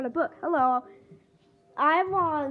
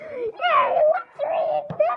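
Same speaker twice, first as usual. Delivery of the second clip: high excited cheer; 13 semitones higher than usual. Acted. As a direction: cheer like a tiny kid. Character cheering.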